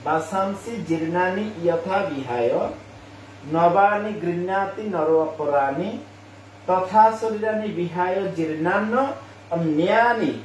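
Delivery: slow syllable-by-syllable Sanskrit reading; slow clear recitation; careful recitation. Shown as a demonstration. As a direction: say "জীর্ণান্ন অন্যানি" with the doubled n held. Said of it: "তারপরে আবার বলছি".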